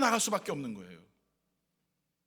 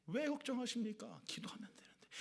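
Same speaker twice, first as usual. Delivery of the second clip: much softer, fading into a whisper on 기도하면 되는데; 13 dB quieter than usual. Shown as a demonstration. Pastor softly repeats the neon sign's words, whispered. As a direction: hush it like a secret.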